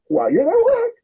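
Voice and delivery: high incredulous "what"; a hammed-up wait what; mock surprise. Son hearing news from mother, high mock surprise. Son's surprised reply retold.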